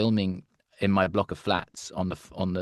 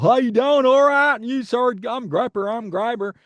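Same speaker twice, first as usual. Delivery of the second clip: hammed-up deep South country boys; deep Southern accent; 13 semitones higher, 7 dB louder than usual. Actor voicing the US scouts in a loud, high Southern accent. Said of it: "like we're from the deep South".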